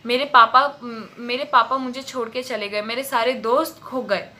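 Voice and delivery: usual speaking voice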